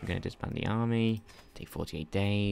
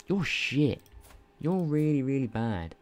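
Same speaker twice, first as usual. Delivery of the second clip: mock insult; 7 semitones higher, 5 dB louder than usual. Real, not acted. Insulting his character's traits.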